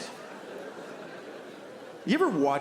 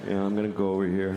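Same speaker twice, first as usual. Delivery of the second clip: quick, offhand dog voice, lower; dog's inner voice; 4 semitones lower, faster than usual. Pretend. Comic voicing his dog's casual thoughts. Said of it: "look around like".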